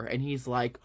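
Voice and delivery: ordinary talk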